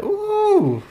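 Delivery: high swooping ooh, then eager self-important boast; dim wannabe aristocrat. Acted. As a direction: play a foolish rich man, pleased with himself. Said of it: "Dexter, he's like".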